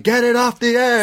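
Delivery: high-pitched mock-desperate yell; imitating a frantic screamed demand; retold screaming. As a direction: cry it out as the cliffhanger line. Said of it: "he just starts screaming this repeatedly".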